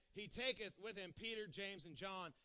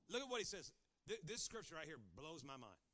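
usual speaking voice

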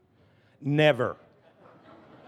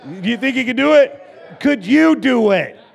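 loud, high, building to a shout; rallying challenge; 5 semitones higher, 6 dB louder than usual. Real, not acted. Pastor challenging congregation to go louder, rousing shout. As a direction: dare the crowd to go bigger.

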